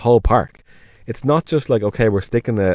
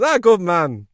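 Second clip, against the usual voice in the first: higher, cheery "good man"; a retold host's pitched-up, cheery approval; hearty approving host; 8 semitones higher, slower than usual. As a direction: clap him on the back with your voice. Host approving Irish answer.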